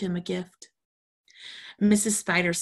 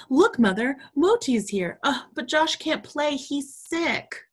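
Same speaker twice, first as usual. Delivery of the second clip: put-on higher and louder, eager child voice; excited; 9 semitones higher, 4 dB louder than usual. Sammy excitedly telling Mother that Moti has arrived. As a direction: bubble over with news. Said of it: "Sammy was excited".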